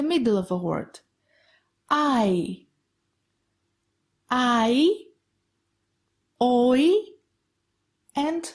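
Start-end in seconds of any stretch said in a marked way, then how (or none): 1.9-5.0 "I" as one gliding sound, ah into ee; "I" said by itself like English eye; diphthong demo. Shown as a demonstration. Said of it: "let's go to some other diphthongs". 6.4-6.9 "oi" as one gliding sound, o into ee; "oi" said by itself, as in boy; diphthong demo. Shown as a demonstration. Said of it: "let's go to some other diphthongs".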